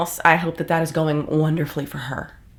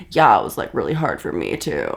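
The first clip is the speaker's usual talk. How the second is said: mock self-pitying Ned; 10 semitones lower than usual. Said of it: "have him be like".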